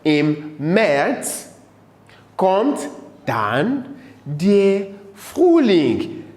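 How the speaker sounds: word by word, with long gaps; deliberate, spaced-out teacher's reading; slow German model. Shown as a demonstration. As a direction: recite it slowly for learners to repeat. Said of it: "wieder auf Deutsch".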